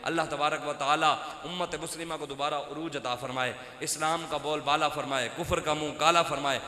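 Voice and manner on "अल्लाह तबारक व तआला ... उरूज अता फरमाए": ordinary delivery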